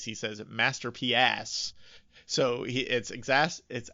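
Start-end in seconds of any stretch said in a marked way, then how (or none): 0.5-1.7 "masterpiece" drawn out, ending like "pee-ass"; pseudo-French masterpiece. Shown as a demonstration. Explaining the rapper's rhyme with whack, pseudo-French "masterpiece". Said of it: "with a pseudo-French accent on masterpiece".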